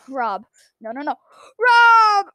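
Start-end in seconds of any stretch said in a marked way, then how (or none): 1.6-2.3 man down shout. Acted. Friend dying in game.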